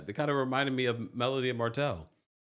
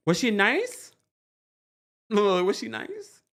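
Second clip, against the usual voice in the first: high, sly teasing ask; teasing question; 9 semitones higher, 3 dB louder, slower than usual. Real, not acted. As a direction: needle them with a knowing rise. Sly repeated question about Jazzy.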